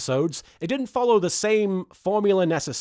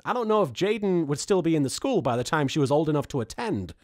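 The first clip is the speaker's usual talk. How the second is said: normal talking voice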